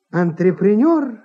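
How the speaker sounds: usual speaking voice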